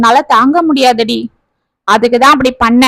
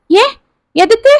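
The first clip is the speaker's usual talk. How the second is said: higher-pitched, startled father asking why; startled questioning; 10 semitones higher than usual. A narrator's reading. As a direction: react with alarm, pitch jumping up. Father shocked at broker's bad news. (அதிர்ச்சியுடன் உயர்ந்த குரலில் கேள்வி)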